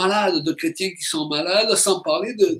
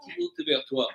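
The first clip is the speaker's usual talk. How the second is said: soft, high, quick prompt; feed the singers the next line softly; spoken lyric cue; 8 semitones higher, 11 dB quieter than usual. Real, not acted. Spoken cue before sung line. (souffler les paroles doucement)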